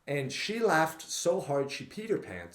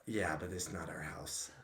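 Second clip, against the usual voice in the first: quoting dad; 12 semitones lower, 13 dB quieter than usual. Retold or imitated. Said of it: "my dad's like".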